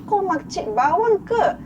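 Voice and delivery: high, mocking telling-off of the haters; sassy rant. Real, not acted. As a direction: scold the busybodies, head tilted, sassy. YouTuber ranting at haters in video. (sindiran tinggi dan lantang kepada makcik bawang)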